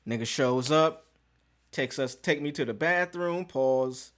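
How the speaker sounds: ordinary talk, nothing marked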